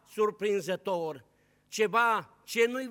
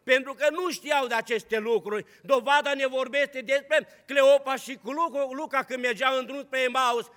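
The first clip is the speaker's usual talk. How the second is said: ordinary talk, nothing marked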